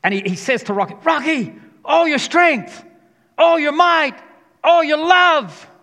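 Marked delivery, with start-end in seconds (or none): 1.0-4.1 high, loud, slow fired-up cry; passionate trainer yell. Retold or imitated. Trainer in Rocky's corner before the round, shouting. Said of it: "He's talking so passionately". 4.6-5.7 high, loud, drawn-out cry on love; passionate trainer yell. Retold or imitated. Trainer urging Rocky in the final round, yelling. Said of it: "He's talking so passionately".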